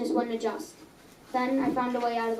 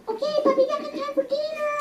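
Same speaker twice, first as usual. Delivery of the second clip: much higher motherly voice; mother's voice. Acted. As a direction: answer as the mom, pitched way up. Mother answers Penboy.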